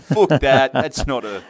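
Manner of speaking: wobbly mock Scottish accent; badly faked, drawn-out Scottish. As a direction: attempt a Scottish heckler, accent slipping about. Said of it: "obviously in a Scottish accent".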